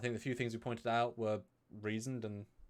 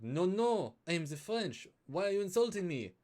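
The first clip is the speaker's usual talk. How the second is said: put-on higher, louder offended French accent; 7 semitones higher, 3 dB louder than usual. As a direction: protest indignantly in a French accent. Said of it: "with that French accent comes aboard".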